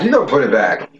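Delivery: normal talking voice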